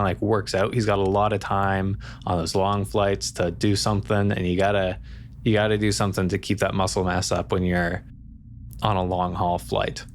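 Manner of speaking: ordinary delivery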